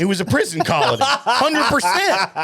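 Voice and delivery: chuckling while agreeing with a cohost's joke; laughing. Real, not acted. Laughing to his cohost about Australia's prison-colony past.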